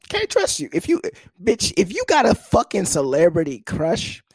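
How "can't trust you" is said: incredulous. Real, not acted. High incredulous emphasis.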